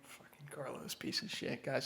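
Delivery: hissed whisper away from the phone; sneering whispered grumble before picking up; whispered aside. Pretend. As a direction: curse him under your breath. Role-played aside before answering.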